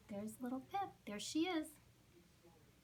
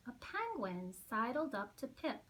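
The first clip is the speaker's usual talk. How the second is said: plain reading voice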